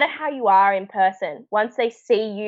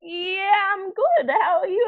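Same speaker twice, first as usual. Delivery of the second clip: higher mock-awkward reply; imitating an awkward castmate, pitched up; awkward Tim; 11 semitones higher, slower than usual. Tim answers her awkwardly, pitched-up mock reply. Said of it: "Tim's also a little bit awkward".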